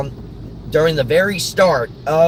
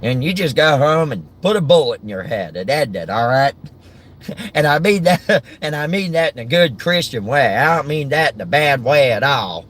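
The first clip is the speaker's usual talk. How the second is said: Larry the Cable Guy. Acted. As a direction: carry on as a folksy Southern comedian, amused. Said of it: "But Larry the Cable Guy's like".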